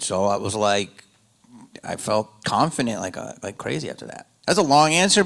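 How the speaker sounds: ordinary talk, nothing marked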